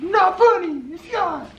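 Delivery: high, loud re-enacted protest; frustrated outburst. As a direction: cry it out, acting out past frustration. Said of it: "super frustrated".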